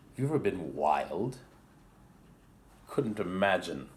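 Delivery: ordinary delivery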